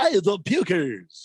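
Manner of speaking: loud, hammed-up radio announcer parody; joking puker voice. Host joking with a colleague about pukers, mock announcer voice. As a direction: belt it like an overblown broadcaster. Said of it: "always joking around about".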